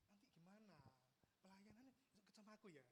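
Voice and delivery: very hushed, confidential murmur; imitated close talker. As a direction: act out talking intimately close, barely audible. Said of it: "ngomongnya gini".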